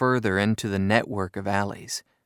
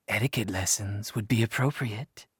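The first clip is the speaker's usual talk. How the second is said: husky; 2 semitones lower, 5 dB quieter than usual. A narrator's reading. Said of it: "in a husky voice".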